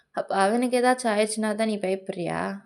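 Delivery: understated confrontational question; accusing retort. Acted. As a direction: throw the worry back as an accusation. Partner throws the worry back.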